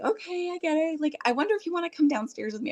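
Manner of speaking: high, singsong soothing voice; high-pitched, placating lilt; coaxing grandma. Acted. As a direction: gently humour an upset toddler.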